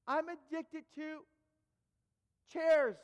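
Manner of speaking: slow, high build to a loud last word; dramatic confession. Retold or imitated. Preacher reveals his addiction to junior highs, dramatically. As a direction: draw out the reveal, pause, then cry it out. Acted climax of the fake confession.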